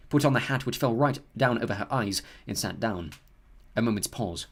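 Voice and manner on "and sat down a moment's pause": usual speaking voice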